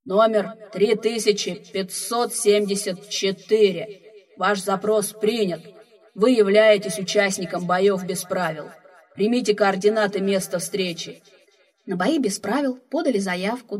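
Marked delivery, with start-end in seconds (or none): 0.0-11.2 another booming voice over the loudspeakers; slow, loud station announcement; second speaker voice. Acted. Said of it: "второй голос из громкоговорителей". (второй гулкий голос из громкоговорителей)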